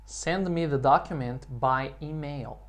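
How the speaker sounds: slow, evenly spaced for repeating; slow model sentence. Shown as a demonstration. Teacher gives English students a sentence to repeat, slowly. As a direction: say it slowly for learners to copy.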